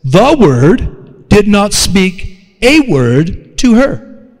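measured, word-by-word delivery; slow and deliberate. Shown as a demonstration. Preacher rephrasing Jesus's silence for the congregation, slow and deliberate. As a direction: spell it out slowly for weight. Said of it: "let me say it like this".

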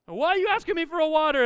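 high, loud mock yell; exasperated dad. Dad to kids asking for water, exasperated yell. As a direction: play a dad snapping at needy kids.

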